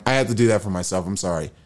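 ordinary talk

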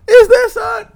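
very high, loud imitated outburst; exaggerated outraged shout; mock anger. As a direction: yell it as a hammed-up angry imitation. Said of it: "you can see the anger in their face".

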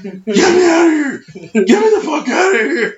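frantic shout from the hole; panicked scream. Retold or imitated. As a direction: scream to be dug out, half laughing. Screaming in panic, buried among bees.

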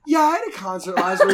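laughing. Retold or imitated. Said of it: "I'm like".

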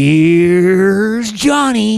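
long drawn-out, slow "Here's Johnny"; famous horror-movie catchphrase. Acted. Quoting The Shining to the co-host, drawn-out impression. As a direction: stretch the first word, then pounce on the name.

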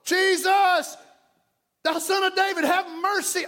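high, pleading call to Jesus; blind man crying out. Acted. Preacher acts the blind men begging Jesus, crying out. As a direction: call out like a roadside beggar, pitched high. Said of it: "The blind man on the side of the road".

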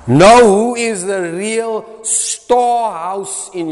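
each phrase stretched slow, hitting know; preacher spelling out the point slowly; slow emphatic. Acted. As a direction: lay it down slowly, word by word. Preacher drives home point.

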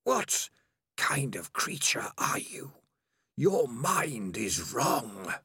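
slow, eerie, whispered suspicion; suspicious whisper. Acted. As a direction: hiss it distrustfully. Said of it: "it sounded very suspicious".

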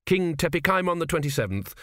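usual speaking voice

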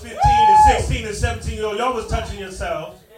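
loud hype shout; shouting at crowd. Real, not acted. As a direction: call out the audience. Calling out the crowd.

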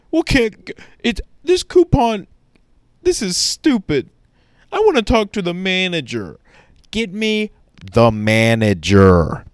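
high, loud demanding Karen voice; whiny, pushy customer demanding service; entitled customer. Retold or imitated.

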